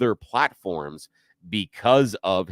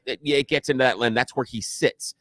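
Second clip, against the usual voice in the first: ordinary delivery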